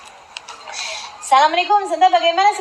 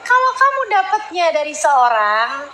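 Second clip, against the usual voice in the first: ordinary delivery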